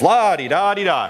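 dismissive sing-song la-di-da; playful mockery. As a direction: tease the rival team's fans lightly. Mocks talk about the Cyclones.

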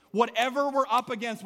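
ordinary delivery

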